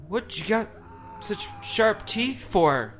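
put-on high, slow boy's voice; frightened boy. Boy questions the creature.

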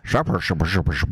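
goofy puppet voice, muffled and silly; goofy muffled sock puppet. Pretend. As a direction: play a squeaky little sock puppet. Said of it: "Give me your best sock puppet voice".

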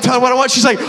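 ordinary talk, nothing marked

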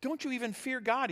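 high-pitched, indignant rebuke. Acted. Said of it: "He rebuked the other".

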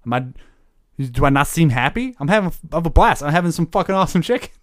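loud, pushy Happy Gilmore bluster; a barbarian bristling at a stranger in a New York voice; angry New York tough guy. Acted. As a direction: get offended and loud about your name, Brooklyn style. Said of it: "kinda slipped into New York".

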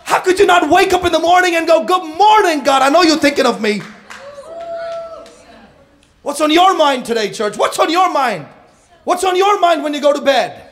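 loud, high, driving sermon delivery; preaching at full force. Acted. Pastor rousing the church, loud full-force preaching. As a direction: pound the message home to the congregation.

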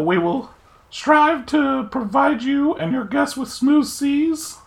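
very high, squeaky little fairy officer voice; fairy commodore. Acted. Tiny fairy commodore welcomes the queen aboard, very high squeaky voice. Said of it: "You asked for this voice".

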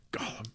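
hushed throaty gollum; whispered gollum. Acted. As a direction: swallow the word in a whisper.

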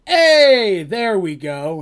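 high, loud, bright greeting; eager hey. Acted. Darcy greeting the guard at the gate, high and loud. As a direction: call out hey with a burst of delight.

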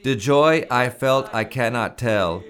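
"The joy I felt I cannot tell" recited with lifted, full voice; solemn verse recital. Shown as a demonstration.

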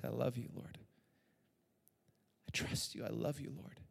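soft, near-whispered words to God; hushed prayer. Retold or imitated. Pastor retelling his private prayer, hushed and tender. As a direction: pray it quietly, almost breathing it.

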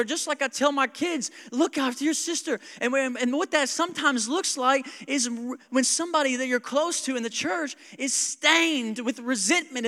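loud, high, driving sermon voice; full-force preaching. Acted. Pastor pleading with the congregation, full-force preaching. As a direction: preach it hard to the church.

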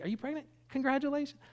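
high-pitched retold surprise; surprised congratulations. Preacher retelling his reply to a pregnant stranger, surprised congratulations. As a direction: jump up high, as if just noticing.

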